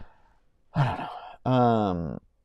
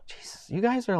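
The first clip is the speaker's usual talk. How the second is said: overwhelmed whisper; 11 semitones higher, 7 dB quieter than usual. Real, not acted. Overwhelmed by viewer donations.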